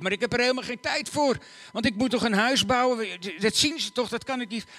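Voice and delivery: high, loud complaining; protesting villager. Acted. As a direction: object that it's impossible. Another villager cries out.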